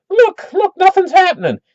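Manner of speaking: high-pitched, invincible little show-off; boastful kid. Retold or imitated. Jimmy tells watching kids he is immune, high boastful kid voice. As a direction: crow like a boy proving he's tough.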